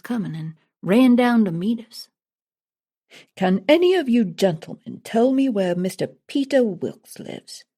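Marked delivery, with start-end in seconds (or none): 3.3-7.6 a hint of a put-on English accent; mild mock English accent; fake English accent. A narrator's reading. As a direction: lightly play a sham Englishman. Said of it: "in his funny accent".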